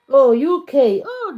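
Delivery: delighted surprise at the answer; surprised oh. Real, not acted. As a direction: react with real surprise. Reading chat, surprised by answer.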